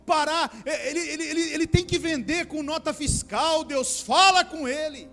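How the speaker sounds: loud, high-pitched intercession building to a shout; acted shouted prayer, urgent and insistent; mock fervent prayer. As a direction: plead with God at full volume, acting it out. Acts out a desperate intercessory prayer.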